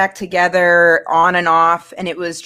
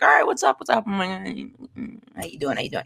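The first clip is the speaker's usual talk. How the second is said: imitated cool greeting; 5 dB quieter than usual. Voicing the girl's cool greeting exchange.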